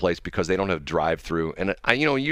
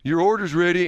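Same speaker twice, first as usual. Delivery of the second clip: order caller impression; 9 semitones higher than usual. Host showing how the counter worker gives your number, sing-out announcer. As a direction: imitate someone calling orders over a microphone. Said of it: "there's a guy in a microphone".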